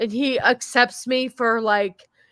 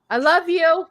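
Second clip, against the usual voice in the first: shouted; 6 semitones higher than usual. Real, not acted. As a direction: call it out with love. Shouting love to a friend.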